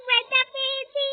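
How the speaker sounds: put-on high, loud hurt child wail; pained whimper. Injured child complains loudly to the doctor, pained. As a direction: cry out that it hurts.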